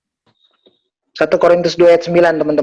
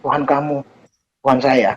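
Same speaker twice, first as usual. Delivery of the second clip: ordinary talk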